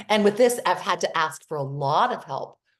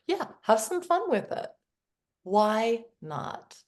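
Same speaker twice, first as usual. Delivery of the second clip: ordinary talk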